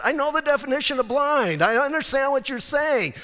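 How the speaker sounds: high-pitched, emphatic retort; acted patient insisting he has understood; exasperated insistence. Patient answering doctor's repeated warning, exasperated.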